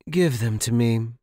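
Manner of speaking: stern order. Acted. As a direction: bark the order at the servant. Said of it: "the woman ordered".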